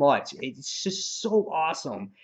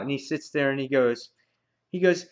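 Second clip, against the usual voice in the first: usual speaking voice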